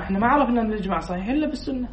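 usual speaking voice

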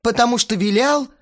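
high, loud outraged outburst; angry yelling. A narrator's reading. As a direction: yell the complaint out. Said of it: "заорал Астарот". (орёт возмущённо, высоким голосом)

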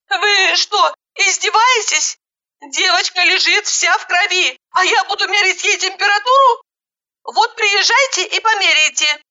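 high-pitched indignant tirade; outraged. Acted. Caller furious with an unhelpful ambulance dispatcher, outraged. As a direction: rant in a high, exasperated voice.

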